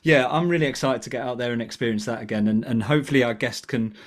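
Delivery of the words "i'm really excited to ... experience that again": usual speaking voice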